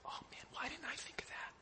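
hushed, whispered regret; envious whisper. Acted. Disciples watching Peter from the boat, envious whisper. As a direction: whisper it to yourself, kicking yourself.

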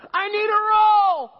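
put-on high, loud, slow child's demand; kid shouting. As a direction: blurt it across the restaurant like a kid. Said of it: "your kid's like".

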